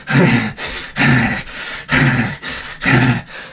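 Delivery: repeated rough bursts of breath, no words; worn-out break-room noises. Acted. Forklift driver in break room, frazzled breath noises. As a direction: act frazzled with wordless puffs. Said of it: "I'd be in a break room like this".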